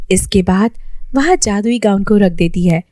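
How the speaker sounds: ordinary delivery, nothing marked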